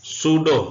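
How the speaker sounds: सूडो said firmly as soo-doh, no p; silent p. Shown as a demonstration. Teacher to students, firm सूडो with silent p. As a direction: say सूडो clearly with the p dropped. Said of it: "पी silent है".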